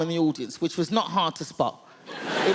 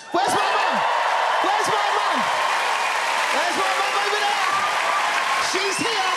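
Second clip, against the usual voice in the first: high, ringing shout to the crowd; excited calling out; 12 semitones higher, 4 dB louder than usual. Real, not acted. Comedian hunting for mum in the audience, excited high shout. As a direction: call out high across the room.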